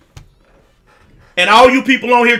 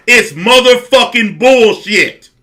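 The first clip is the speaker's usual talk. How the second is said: usual speaking voice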